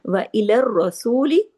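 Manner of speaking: इलर रसूली joined tightly, the doubled रा held heavy; tajweed recitation. Shown as a demonstration. Teacher joins इलर रसूली firmly, heavy doubled रा. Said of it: "इलर रसूली सख्ती से जोड़ना है".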